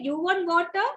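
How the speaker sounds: quick rising "You want water?"; unmodelled question. Shown as a demonstration. Teacher shows what not to say, quick question. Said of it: "don't say".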